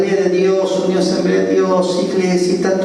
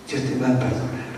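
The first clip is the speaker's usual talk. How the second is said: soft, low reassurance; gentle assurance; 5 semitones lower, 8 dB quieter, faster than usual. Retold or imitated. Pastor answers the robber's doubt, gentle assurance. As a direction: drop the voice to comfort him.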